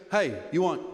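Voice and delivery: low curt masseuse question; masseuse's offer. Retold or imitated. Comic imitating the masseuse making her offer, low and blunt. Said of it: "And she goes".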